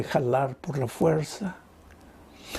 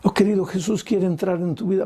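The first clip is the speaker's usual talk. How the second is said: usual speaking voice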